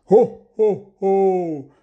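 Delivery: loud, high, booming ho-ho-ho; jolly Santa laugh. Acted. Santa's farewell laugh, jolly and booming. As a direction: laugh out big and merry. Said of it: "gave a jolly old laugh".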